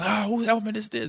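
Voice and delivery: high, mock coach yell. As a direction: call out across the field like an annoyed coach. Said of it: "the coaches yell".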